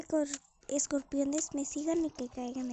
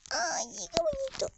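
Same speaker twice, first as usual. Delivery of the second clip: cute gush; 11 semitones higher than usual. Real, not acted. Gushing over imagined torches.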